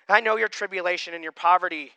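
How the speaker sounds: loud, deliberate Bible reading to the congregation; reading Revelation aloud to the church, in a proclaiming voice; scripture reading. Acted. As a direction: proclaim the passage from the pulpit. Rereads verse aloud.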